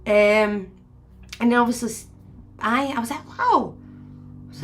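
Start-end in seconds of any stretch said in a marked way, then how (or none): 3.4-3.7 high, swooping wow; mildly acted astonishment; retold amazement. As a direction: let the pitch leap up in wonder. Retold amazement at son's legs.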